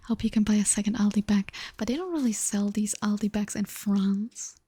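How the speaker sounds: ordinary talk, nothing marked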